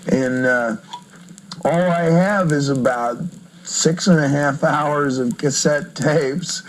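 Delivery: ordinary talk, nothing marked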